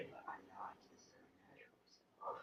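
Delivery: hushed whisper. Real, not acted. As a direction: whisper it under your breath. Whispered denial of being drunk.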